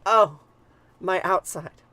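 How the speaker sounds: slow, loud drawn-out moan of pain; moaning sigh. Acted. Goddess in labor, a slow loud moaning cry. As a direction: let the goddess groan it out slowly.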